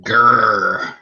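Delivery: drawn-out pirate growl on "Gur". Pretend. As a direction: draw out the R like a playful pirate. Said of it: "He's a pirate".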